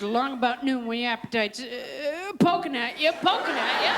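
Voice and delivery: plain start building to lilting, animated jingle; inspired audition read with rising flourishes; gifted actress's reading. Acted. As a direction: swing up playfully on each "poking at you". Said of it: "that one gifted woman, that fine actress".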